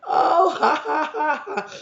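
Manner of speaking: loud high cackling laugh, over the top; hammed-up shrieking laughter at a funny story; mock crowd roaring with laughter; obnoxious laughing.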